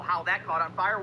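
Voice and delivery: ordinary talk, nothing marked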